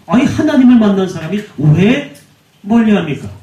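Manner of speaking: ordinary talk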